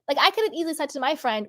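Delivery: usual speaking voice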